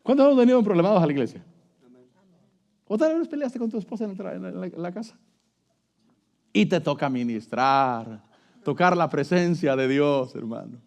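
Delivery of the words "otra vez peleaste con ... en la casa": ordinary talk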